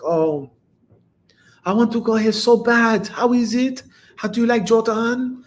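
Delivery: put-on high-pitched French-accented woman. French woman messaging him about visiting Jordan, imitated high French accent. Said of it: "your heavy ass accent".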